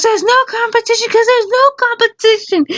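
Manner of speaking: high squeaky flustered retort. Retold or imitated. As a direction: sputter a comeback that makes no sense.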